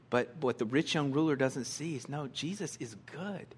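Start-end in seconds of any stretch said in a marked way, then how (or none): none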